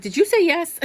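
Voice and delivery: high, loud, pleading call to the sky; hopeful mock shout. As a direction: shout up hopefully, half joking. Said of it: "And she's like".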